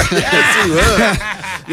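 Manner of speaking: laughing through the words; amused, breaking into a laugh. Real, not acted. Laughing at old radio memory.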